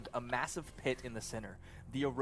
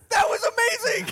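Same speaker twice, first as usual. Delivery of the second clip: screaming with delight; excited shout; 18 dB louder than usual. Real, not acted. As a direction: yell it out thrilled. Table erupts at roll.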